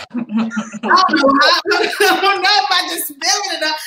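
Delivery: high-pitched, talking through laughter; laughing. Real, not acted. Mother telling on her spelling son, laughing. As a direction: laugh helplessly as you explain.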